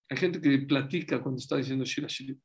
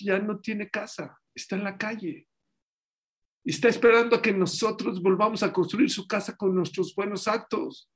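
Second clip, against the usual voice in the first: ordinary talk, nothing marked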